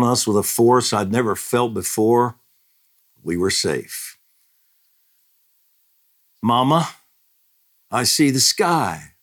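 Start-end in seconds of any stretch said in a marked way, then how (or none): 6.4-9.1 put-on child's voice. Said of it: "little William said".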